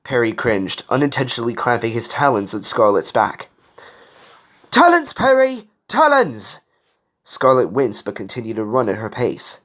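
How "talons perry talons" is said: high, loud yelp of protest; urgent raised cry; pained warning. A narrator's reading. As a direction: call out sharply as claws dig in. Pained warning as claws dig in.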